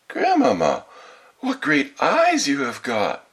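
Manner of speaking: high, loud, slow wonder; greatly amazed. A narrator's reading. Girl marvelling at grandmother's eyes, greatly amazed. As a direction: gape aloud like a little girl. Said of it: "being greatly amazed".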